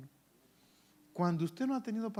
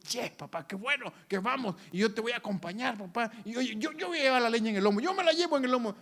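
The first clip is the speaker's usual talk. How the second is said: put-on excited boy, higher, fast and louder; Isaac chattering happily to his father, eager and fast; eager young son; 7 semitones higher, 5 dB louder, faster than usual. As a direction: bubbly kid keen to carry the wood. Eager son voiced in story.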